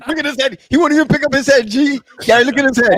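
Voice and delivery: high, laughing teasing. Real, not acted. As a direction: tease him while laughing. Laughing at friend.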